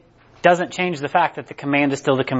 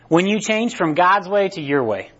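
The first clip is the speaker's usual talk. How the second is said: usual speaking voice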